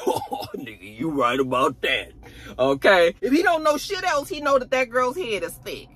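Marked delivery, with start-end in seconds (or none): 0.1-3.1 low acted laugh into the words; amused agreement. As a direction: chuckle as you concede.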